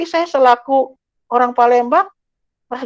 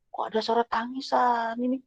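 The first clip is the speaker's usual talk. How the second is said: much softer, a puzzled hush to himself; hushed wondering; 14 dB quieter than usual. Retold or imitated. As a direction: wonder quietly, half to yourself. Wondering aloud about crying sound.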